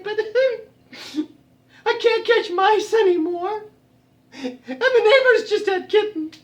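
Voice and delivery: high, wailing cat voice; calico cat. Acted. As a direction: meow the woes of getting old. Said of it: "And she said".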